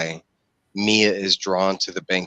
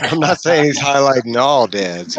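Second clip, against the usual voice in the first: words spilling out through a laugh, higher and louder; 6 semitones higher, 6 dB louder than usual. Real, not acted. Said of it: "laughing while speaking".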